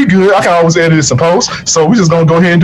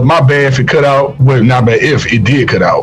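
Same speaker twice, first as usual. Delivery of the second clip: usual speaking voice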